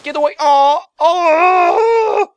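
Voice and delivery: high, loud cry of alarm; panicked shriek. Real, not acted. Gamer being killed by a chicken, panicked screaming. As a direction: shout it away, then scream.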